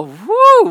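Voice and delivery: high startled "whoo"; sudden high-pitched yelp of surprise; pained whoop. Retold or imitated. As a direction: whoop as if jabbed by sudden pain. Retold reaction to sudden shingles pain.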